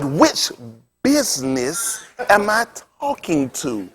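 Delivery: very slow, softly drawn-out imitation; slow-talking preacher. Imitating the preacher asking which caller, very slow talk. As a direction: mimic his careful, dragging phone manner. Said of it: "He talked like that".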